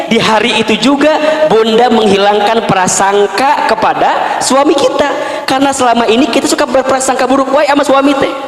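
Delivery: ordinary delivery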